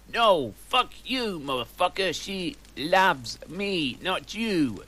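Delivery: a hint of an angry Vampire; mock shout. A narrator's reading. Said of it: "shouted Vampire".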